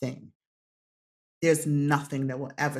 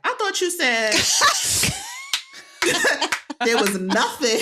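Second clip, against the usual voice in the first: high, loud, laughing through the quote; laughing mock accusation; 6 dB louder than usual. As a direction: giggle out a playful gotcha as the kid. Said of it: "Kaya will sometimes be like".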